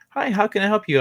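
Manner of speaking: chipper helpdesk greeting. Retold or imitated. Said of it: "they're like".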